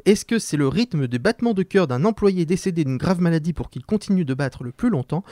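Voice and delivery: normal talking voice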